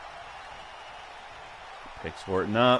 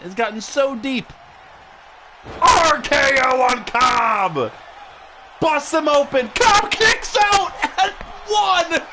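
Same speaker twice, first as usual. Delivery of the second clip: wrestling commentator; 12 semitones higher than usual. Acted. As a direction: call the big finish live. Live play-by-play excitement.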